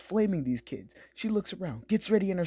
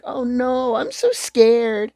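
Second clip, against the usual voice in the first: hammed-up cute fright, slow and a bit louder; mock cute scared; 5 semitones higher, 7 dB louder, slower than usual. Girl acting cute for her stream chat, mock scared. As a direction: fake being scared to look cute. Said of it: "trying to be all cute and everything".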